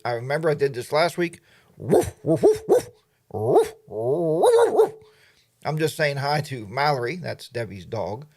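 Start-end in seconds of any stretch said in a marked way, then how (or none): 1.8-4.9 barking at dog. Pretend. Greeting Debbie's dog Mallory with playful barks. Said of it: "that's how I talk to her". 7.2-8.2 put-on a touch of slow, soft baby talk; talking to a dog. Explaining to chat about Debbie's dog, a hint of baby talk. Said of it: "that's how I talk to her".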